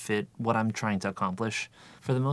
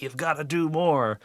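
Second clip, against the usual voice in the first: higher, mock-insistent nag; lightly acted inner taskmaster, pitched up; pushy inner voice; 6 semitones higher than usual. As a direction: push like a nagging conscience. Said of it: "the one that's like".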